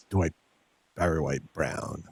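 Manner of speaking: deep, low crooning baritone. Pretend.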